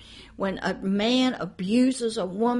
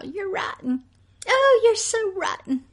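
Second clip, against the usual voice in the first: high, sugary mock scolding; imitating a smiling mother's sing-song "rotten"; mother with a smile; 8 semitones higher than usual. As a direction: tease the child sweetly while insulting him. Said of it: "calling your son with a smile".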